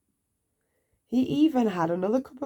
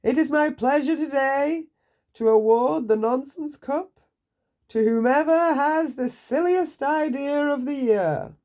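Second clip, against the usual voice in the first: grand royal address; 8 semitones higher, 5 dB louder than usual. A narrator's reading. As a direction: announce it like a pompous king. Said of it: "he said to the crowd".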